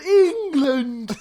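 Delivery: high, loud panicked British; mock British accent. Student panicking when asked origin, high exaggerated British. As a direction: blurt it high in overdone British. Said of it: "I totally panicked, and I said".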